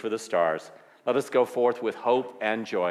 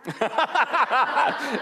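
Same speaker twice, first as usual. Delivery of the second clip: high laugh; 4 dB louder than usual. Real, not acted. Laughter at pancake moment.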